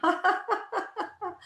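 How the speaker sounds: long high staccato giggle; high exercise laugh; laughter. Shown as a demonstration. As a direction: laugh on purpose until it fades. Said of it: "with a sense of fun and laughter".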